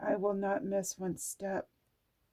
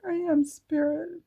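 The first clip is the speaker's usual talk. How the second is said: spirit exclaim; 8 semitones higher, 4 dB louder than usual. Real, not acted.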